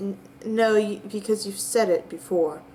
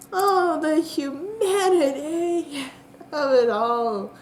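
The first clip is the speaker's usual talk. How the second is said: higher, slow, hammed-up despair; mock lament; 7 semitones higher, slower than usual. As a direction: wail it like a melodrama, tongue in cheek. Mock lament about gnome situation.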